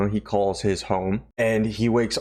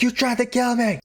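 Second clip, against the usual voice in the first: high indignant cry. Retold or imitated. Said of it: "He's like".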